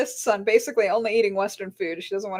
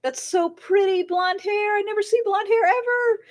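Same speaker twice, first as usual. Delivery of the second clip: half-mocking fan gush, pitched up; gushing strangers; 10 semitones higher than usual. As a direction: coo like an admiring stranger on the subway. Strangers on subway admiring.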